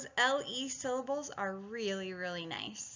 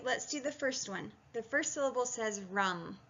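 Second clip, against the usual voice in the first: ordinary talk, nothing marked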